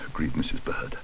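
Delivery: plain reading voice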